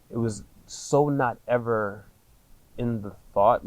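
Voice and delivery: ordinary delivery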